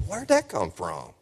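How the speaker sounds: slow, soft mock puzzlement; lightly acted sheepish surprise; feigned bafflement. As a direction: wonder aloud, as if innocent of the slip. Acting surprise at own slipped swearword.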